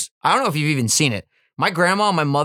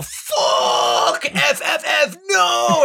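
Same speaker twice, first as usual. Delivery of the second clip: high wailing cry; 13 semitones higher, much slower than usual. Retold or imitated. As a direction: wail it like a panicked chat. Chat reaction retold.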